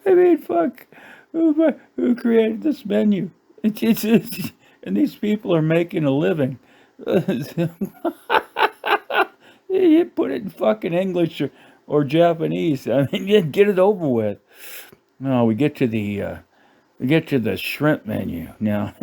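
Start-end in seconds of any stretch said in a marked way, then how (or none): 0.1-8.3 high, squeaky talk through real laughter; helpless laughter. Real, not acted. Comic ranting about the menu's creator, helpless laughter. Cracking up over the absurd menu. 9.7-14.4 high-pitched protest on the edge of laughter; exasperated laughter. Real, not acted. Comic begging the menu writer to pick one language, exasperated laughter. Still cracking up over the menu.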